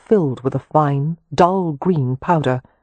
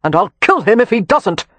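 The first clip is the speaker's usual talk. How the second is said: vengeful; 5 semitones higher, 5 dB louder than usual. A narrator's reading. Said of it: "glared vengefully".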